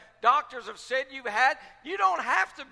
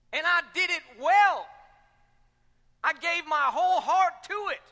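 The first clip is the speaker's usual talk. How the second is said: rising preacher's shout; 5 semitones higher, 7 dB louder than usual. Real, not acted. Preacher climaxes on testimony.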